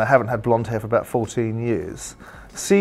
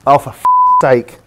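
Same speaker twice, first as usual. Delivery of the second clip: loud, higher groan of exasperation; exasperated outburst; 3 semitones higher, 8 dB louder than usual. Real, not acted. Pundit reacting to his impersonator, exasperated outburst.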